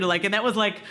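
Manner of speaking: ordinary talk, nothing marked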